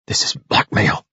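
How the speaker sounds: a voiceless, desperate plea; almost without voice, cornered; desperately. A narrator's reading. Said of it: "he said desperately to Parvana".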